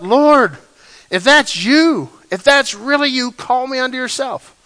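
high, loud call across the water; Peter yelling. Retold or imitated. Peter calling to Jesus from the boat, loud pleading yell. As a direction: call out to someone far off on the waves. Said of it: "And Peter yells out".

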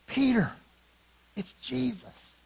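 high, stretched-out realization; awed realization. Acted. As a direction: break astonishing news to a friend, high and slow. John's awed realization, acted.

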